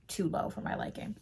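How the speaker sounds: whisper. Real, not acted. Whispered aside about gatekeeping.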